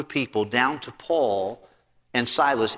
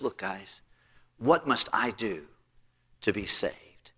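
hushed plea; 4 semitones lower, 6 dB quieter than usual. Retold or imitated. Frightened jailer asks for salvation.

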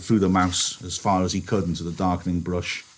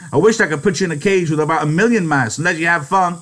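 bitter anger; 10 semitones higher, 7 dB louder, faster than usual. Acted. End of George's rant.

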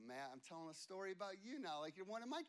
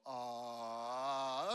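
mock aww; 7 semitones lower, 5 dB louder than usual. Crowd's cooing at puppy love.

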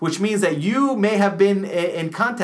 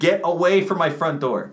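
ordinary talk

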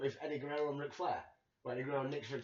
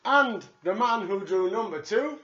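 slow, louder announcement; announcer voice; 3 semitones higher, 8 dB louder, slower than usual. Pretend. Host announcing the number two entrant, louder and slower. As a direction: call out the entrant like a ring announcer.

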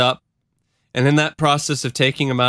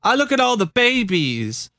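Christine; 10 semitones higher than usual. Acted. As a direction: plead against throwing it all away. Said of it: "a recorded exchange between Christine and Jim Jones".

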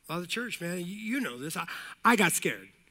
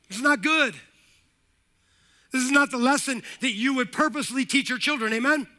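loud, high, driving pulpit cadence; building sermon call; full-force preaching; 7 semitones higher, 7 dB louder than usual. Acted. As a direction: preach it hard to the congregation.

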